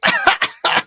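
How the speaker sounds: real squealing laugh; a sudden high giggle; high burst of laughter. Real, not acted. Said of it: "can't even say that with a straight face".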